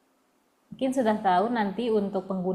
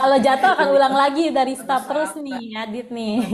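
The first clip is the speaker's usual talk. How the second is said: laughing; 3 semitones higher, 5 dB louder than usual. Real, not acted. Teacher laughing at falls.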